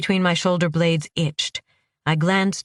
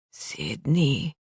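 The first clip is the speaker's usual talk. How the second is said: low, soft groaned name; a dying man groans his friend's name; pained groan; 3 semitones lower, 5 dB quieter than usual. Acted. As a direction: push the name out through pain. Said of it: "Angelo groaned".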